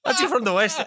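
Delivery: normal talking voice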